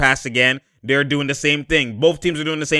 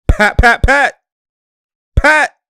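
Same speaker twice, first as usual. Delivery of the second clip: loud, higher calls of the name; calling out; 10 semitones higher, 9 dB louder, slower than usual. Real, not acted. Calling to Pat on screen, loud and high. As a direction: call him over and over.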